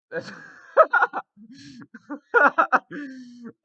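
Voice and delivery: high, loud burst of laughter; helpless loud laughter; loud laughing fit. Real, not acted. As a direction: crack up hard.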